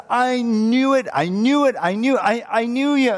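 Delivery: high, insistent repeated reproach; angry accusation. Acted. Jonah attacking God for relenting, angry. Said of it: "Jonah in anger, he attacks God".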